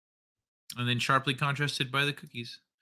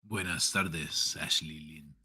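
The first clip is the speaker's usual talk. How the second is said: deep smooth Spanish charmer; much lower, softer mock Spanish; Zoro voice attempt; 9 semitones lower, 4 dB quieter than usual. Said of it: "Let me try one more time".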